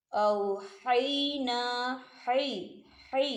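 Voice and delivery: औहैना drawn out, light thin हा; slow Quranic recitation. Shown as a demonstration. Teacher demonstrating thin Ha to students, slow. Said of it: "अब आप हा की मिसाल को देखें".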